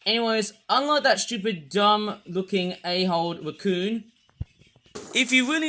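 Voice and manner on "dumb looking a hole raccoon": ordinary talk, nothing marked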